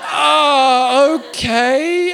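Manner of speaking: long, high, loud "oh", imitated; hammed-up awkward surprise, drawn out; taken aback. Callers react to his adult job.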